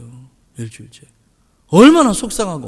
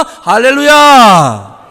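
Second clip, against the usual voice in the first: drawn-out hallelujah; 5 semitones higher, 11 dB louder, much slower than usual. Real, not acted. Exclamation to the congregation.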